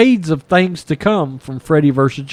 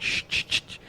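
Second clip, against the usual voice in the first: whispered shush; a doctor hushing a frightened girl, whispered; 15 dB quieter, faster than usual. Retold or imitated. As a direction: hush her in a whisper. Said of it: "He's like".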